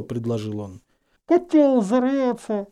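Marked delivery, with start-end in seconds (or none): 1.3-2.7 slow, high, smothered by cloth; indistinct, drawn-out high voice; muffled warning. A narrator's reading. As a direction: warn through a cloth over the mouth. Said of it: "предостерегла Гермиона".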